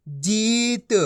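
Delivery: जीत with a drawn-out ee and light t; long ī. Shown as a demonstration. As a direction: stretch the vowel of जीत. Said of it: "इसी तरीके से पढ़ाना है".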